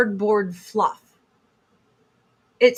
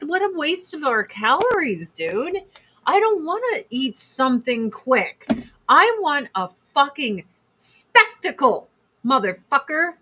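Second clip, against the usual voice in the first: mock indignant rant; 6 semitones higher than usual. To her husband about dinner, hammed-up indignant rant. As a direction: play up the outrage over quick food. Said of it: "And I'm like".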